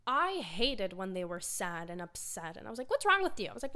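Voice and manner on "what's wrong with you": much higher and louder, demanding; an impatient child's loud demand to sad relatives; exasperated outburst. Retold or imitated. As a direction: blurt it out like an impatient kid. Younger self scolding sad relatives.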